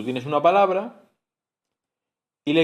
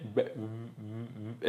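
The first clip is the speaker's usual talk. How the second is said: a slow, hushed "b, b, b", lips popping; slow whispered b; 2 semitones lower, 13 dB quieter, much slower than usual. Shown as a demonstration. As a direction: drag out "b, b, b" very slowly and softly. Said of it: "y es".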